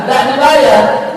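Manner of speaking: ordinary talk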